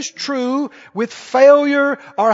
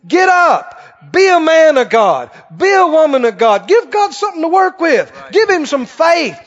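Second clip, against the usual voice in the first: fired-up, emphatic exhortation; forceful preaching; 4 semitones higher, 4 dB louder than usual. Real, not acted. Preacher rousing self-pitying believers to faith, forceful exhortation. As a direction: punch out each command like a revival preacher.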